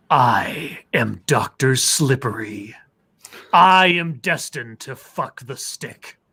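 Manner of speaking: slow, sinister villain announcing himself; evil voice. Acted. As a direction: speak slowly like a gloating supervillain. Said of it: "I have to do my evil voice".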